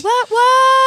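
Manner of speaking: loud, very high cheer; celebratory whoop. Real, not acted. Host cheers news of nearing the patron goal, whooping.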